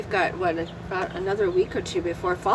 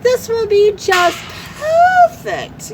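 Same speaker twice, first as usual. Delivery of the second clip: high, sugary mock host voice; sing-song "perfect" rising very high then falling; imitating cooking host; 12 semitones higher, 5 dB louder, slower than usual. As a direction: gush it like a perfect TV cook. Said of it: "She goes".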